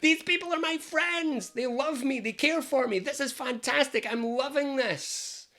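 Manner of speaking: high, contented sing-song voice; happy inner voice. Retold or imitated. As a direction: bask in the warm glow of friendship. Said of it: "this one comes in and goes".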